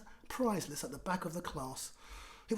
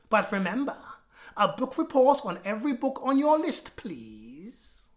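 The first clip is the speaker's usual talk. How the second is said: higher, louder teacher announcement; stern teacher; 6 semitones higher, 10 dB louder than usual. Acted. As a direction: address the class like a bossy teacher. Miss Battleaxe addressing class.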